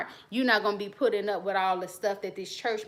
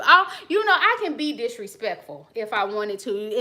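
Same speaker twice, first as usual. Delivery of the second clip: ordinary talk, nothing marked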